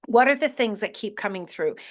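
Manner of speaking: normal talking voice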